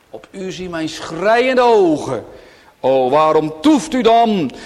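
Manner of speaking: high, loud gospel call; full-force pleading sermon; fervent preaching. Real, not acted. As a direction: thunder the invitation from the pulpit.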